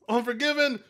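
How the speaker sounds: ordinary delivery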